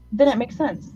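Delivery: ordinary talk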